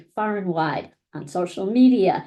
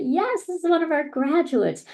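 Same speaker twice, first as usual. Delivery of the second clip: high-pitched, delighted cheer at good news; proud delight; 8 semitones higher than usual. Retold or imitated. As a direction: exclaim it proudly, pitched well up. Delighted reaction to graduates' social posts.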